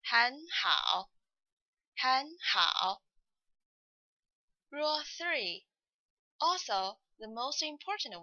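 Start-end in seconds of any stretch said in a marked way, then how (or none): 0.0-3.0 很好 with 很 rising, then 好 dipping; second tone before third. Shown as a demonstration. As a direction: say 很好 lifting 很 into a second tone. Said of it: "should be pronounced as".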